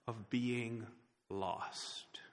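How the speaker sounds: whispered dread on lost; hushed whisper. Acted. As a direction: breathe the word lost as a whisper. Preacher dramatising the terror of lostness.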